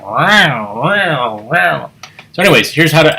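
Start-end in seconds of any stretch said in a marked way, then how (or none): 0.0-1.9 warbling wow wow like a wobbly tape; tape warble. Retold or imitated. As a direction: imitate a warbling tape horn. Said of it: "it sounds like they're going".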